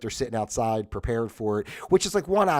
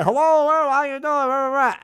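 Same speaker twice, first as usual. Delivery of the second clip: high, slow, loud drawn-out hello; mock salesman call; 13 semitones higher, 6 dB louder, much slower than usual. Imitating salesmen calling through the screen door again. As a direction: sing it out like a pushy salesman at the door. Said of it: "they start yelling in the door again".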